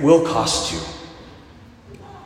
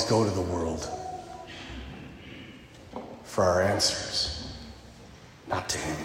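hushed; 11 semitones lower, 8 dB quieter than usual. Real, not acted. Preacher drops to intimate low delivery.

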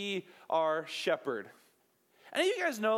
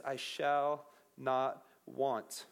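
very slow, lower, reverent; slow solemn reading; 7 semitones lower, much slower than usual. Shown as a demonstration. Pastor reciting the psalm, very slow and reverent. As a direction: let each word land.

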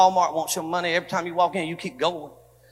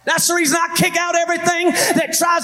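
preaching at full force; 7 semitones higher than usual. Acted. Pastor declaring against segregation to his church, full preaching shout. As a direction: drive it home to the congregation.

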